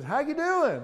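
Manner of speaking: high, friendly hello from a stranger; friendly Southern greeting. Retold or imitated. Pastor imitating a friendly Alabama gas-station man's high greeting. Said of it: "and he said".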